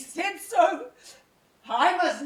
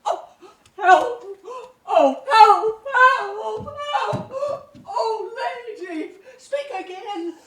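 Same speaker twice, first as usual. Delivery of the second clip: louder, frantic calls for help; desperate cry for help; 4 semitones higher, 5 dB louder, slower than usual. Acted. Emilia finding her dying mistress, frantic loud cries for help.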